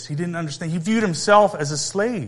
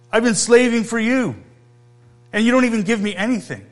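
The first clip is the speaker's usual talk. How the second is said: resentful complaint; 7 semitones higher than usual. Acted. Older son to his father, bitter and aggrieved. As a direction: rattle off the grievance, rushing the end.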